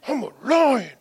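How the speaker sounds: put-on high childlike answer; little boy reply. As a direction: answer like an eager toddler. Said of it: "son's reply".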